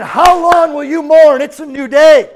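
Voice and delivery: high, loud, emphatic proclamation; preaching shout. Acted. Preacher proclaims God's word to the congregation, shouting. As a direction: shout it out slowly, then ring out the close.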